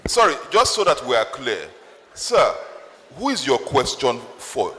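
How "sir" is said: usual speaking voice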